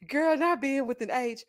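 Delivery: usual speaking voice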